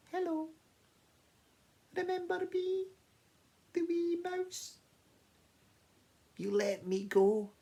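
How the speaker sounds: very high, tiny mouse voice; friendly wee mouse. Acted. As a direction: start squeaky, ease down as you explain. Mouse greets the lion.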